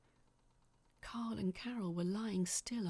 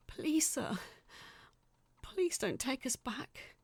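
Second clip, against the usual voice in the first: high, louder pleading through tears; through sobs; 7 semitones higher, 4 dB louder than usual. Acted. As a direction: beg desperately, close to sobbing. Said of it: "Jeremy said through sobs".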